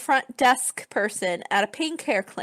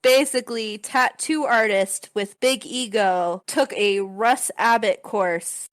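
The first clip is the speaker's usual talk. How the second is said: ordinary delivery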